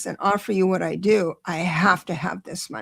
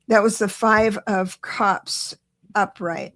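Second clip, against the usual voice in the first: deliberately slower, each word placed; slowing down; slower than usual. Real, not acted. Reader calming her own excitement for the viewer, slower. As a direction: rein yourself in and take it slow. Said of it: "Sorry, let me slow down".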